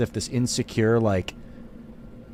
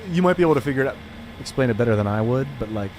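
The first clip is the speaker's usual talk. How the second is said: ordinary talk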